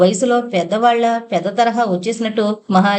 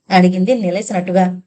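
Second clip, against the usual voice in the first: ordinary delivery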